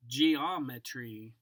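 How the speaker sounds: ordinary delivery, nothing marked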